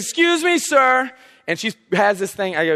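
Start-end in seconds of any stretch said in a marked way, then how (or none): 0.0-1.1 higher, pointed call from the woman; mock stern lady. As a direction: play the angry woman stopping him. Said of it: "And so she says".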